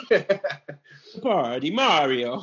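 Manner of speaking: slow, high, hammed-up Italian accent; mock Italian accent. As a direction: stretch it like a cartoon Italian accent, amused. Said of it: "you become Italian too".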